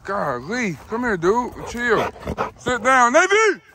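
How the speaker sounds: loud high commands to the dog; calling a dog. Real, not acted.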